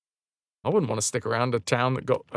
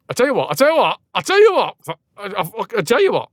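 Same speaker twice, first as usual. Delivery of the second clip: high, loud excited exclaiming; building excitement, tripping over words; 6 semitones higher, 5 dB louder, faster than usual. Real, not acted.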